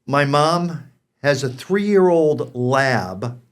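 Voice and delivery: ordinary talk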